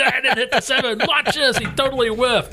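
put-on high, eager kid calling his mom; thrilled kid pulling mom over to watch; excited kid.

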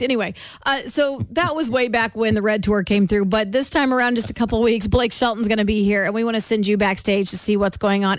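ordinary delivery

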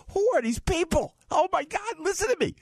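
very high, astonished disbelief; amazed inner voice. Retold or imitated. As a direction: gasp at how nice they are, voice shooting up. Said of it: "in the back of the elevator going".

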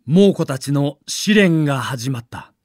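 usual speaking voice

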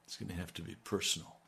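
soft and quick, almost to himself; hushed quick preview; quick soft aside. Acted. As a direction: toss it off quietly before the push. Preacher repeats line for emphasis.

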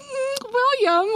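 high swooning sigh. Retold or imitated. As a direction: melt over him, high and breathy. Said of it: "And I'm just like".

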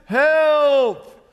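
loud, high, drawn-out holler; hollered cry for help. Acted. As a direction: act out a man sinking, yelling for rescue. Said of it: "he hollers at the top of his lungs".

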